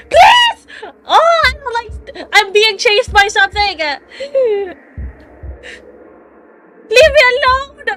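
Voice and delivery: high, loud sobbing plea; terrified crying shout. Real, not acted. Player chased by something, sobbing terrified shout. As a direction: beg and scream through tears.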